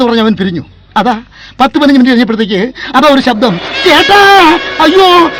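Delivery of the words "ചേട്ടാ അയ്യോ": high, loud panicked cries of help; screaming for help. Acted. Girl in danger begging her lover, panicked loud cries. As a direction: yell for rescue in terror.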